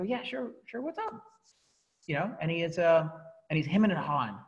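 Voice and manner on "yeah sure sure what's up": casual reply. Retold or imitated. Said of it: "And I go".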